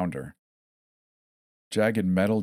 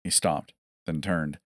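plain reading voice

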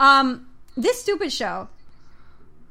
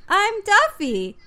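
high, slow cartoon introduction; drawn-out, singsong mock introduction; Duffy; 8 semitones higher, 4 dB louder, slower than usual. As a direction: announce yourself like a kids' show character. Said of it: "And then Duffy goes".

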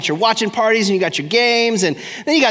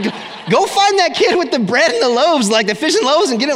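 panicked disciples; 7 semitones higher than usual. Pretend. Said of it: "I bet the disciples are like".

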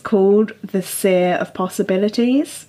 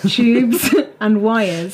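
laughing; 2 semitones higher, much slower than usual. Real, not acted. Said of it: "I read that wrong".

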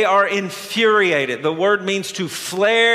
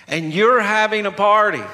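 usual speaking voice

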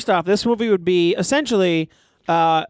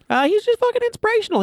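excited; faster than usual. Real, not acted. Gushing about Billy Mays.

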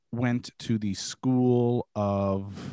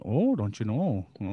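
put-on Midwest accent. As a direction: put on a Minnesota accent, stretching the O's. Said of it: "I love that, that accent".

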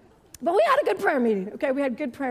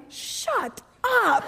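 playful shut up; 7 semitones higher, 3 dB louder than usual. Retold or imitated. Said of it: "and I'm like".

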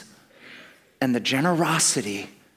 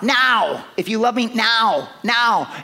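impatient demand; 7 semitones higher, 4 dB louder than usual. Retold or imitated. Demanding love from God on the spot.

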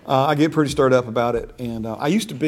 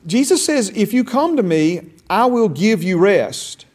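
much higher and louder, slow preaching peak; preaching at full force; 7 semitones higher, 6 dB louder than usual. Acted. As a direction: drive the promise home from the pulpit. Rising sermon intensity.

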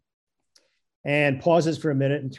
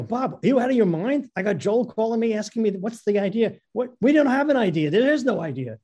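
higher-pitched, incredulous retelling of a panicked call; acted-out alarm, voice pitched up; exasperated protest; 7 semitones higher than usual. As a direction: protest in mock disbelief. Alarmed call to his partner.

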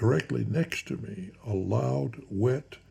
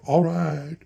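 plain reading voice

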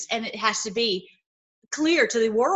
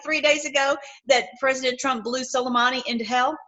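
normal talking voice

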